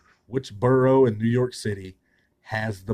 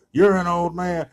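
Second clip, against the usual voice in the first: higher and louder mock taunt; escalating mock trash talk; 8 semitones higher, 9 dB louder than usual. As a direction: shout the jab, raising the stakes. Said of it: "talking trash".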